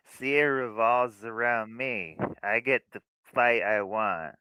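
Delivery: mocking spoiled girl. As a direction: sneer it like a vain party girl. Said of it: "See, she's like".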